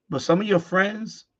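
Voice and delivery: ordinary talk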